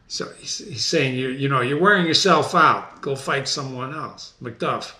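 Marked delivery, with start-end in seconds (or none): none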